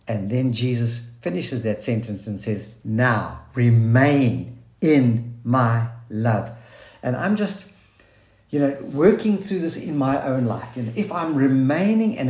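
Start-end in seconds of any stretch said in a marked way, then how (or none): 2.8-6.5 slow emphasis. Real, not acted. Preacher slows quoting Jesus.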